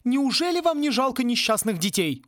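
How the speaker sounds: high-pitched protest; exclaiming. A narrator's reading. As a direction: cry out on behalf of the children. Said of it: "воскликнула Сьюзан".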